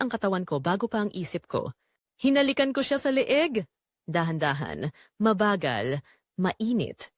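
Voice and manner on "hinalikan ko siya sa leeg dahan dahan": ordinary delivery, nothing marked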